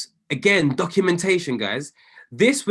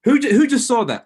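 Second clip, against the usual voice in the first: higher and louder, thrilled; excited cheer over a winning trade; getting excited; 5 semitones higher, 6 dB louder than usual. Real, not acted. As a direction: burst out as the trade hits. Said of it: "getting excited".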